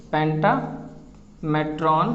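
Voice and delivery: पेंटामेट्रॉन slowly drawn out, syllable by syllable; drawn-out word. Shown as a demonstration. Teacher drawing out the Latin word for students, slowly. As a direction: stretch पेंटामेट्रॉन out slowly for the class. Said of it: "M E T R O N".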